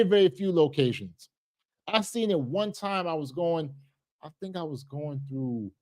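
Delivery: normal talking voice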